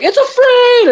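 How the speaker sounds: very high-pitched triumphant cry; hammed-up, shooting way up high; cheering crowd. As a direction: imitate a whole army erupting in joy. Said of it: "And everybody cheers".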